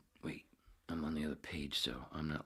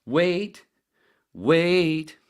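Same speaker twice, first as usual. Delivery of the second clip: rising, much louder wait, wait, wait; loud wait calls; 13 semitones higher, 14 dB louder, much slower than usual. Retold or imitated. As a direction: shout each wait higher. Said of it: "he said".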